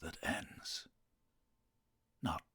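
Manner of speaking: hushed, drawn-out dirge recital; a hint of a mournful bard; Shakespearean dirgy voice. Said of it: "can you recite some Shakespeare".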